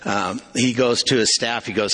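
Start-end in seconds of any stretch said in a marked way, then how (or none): none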